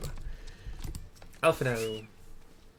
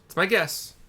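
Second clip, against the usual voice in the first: higher, louder, stressing my; emphatic repeat; 7 semitones higher, 6 dB louder than usual. Shown as a demonstration. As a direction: punch "my" up high. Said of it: "second take pitched up".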